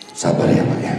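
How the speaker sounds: whispered comfort to a grieving man; hushed consoling. Acted. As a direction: berbisik menghibur. (bisikan lembut untuk bapak yang berduka)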